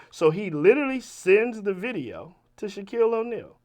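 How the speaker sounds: usual speaking voice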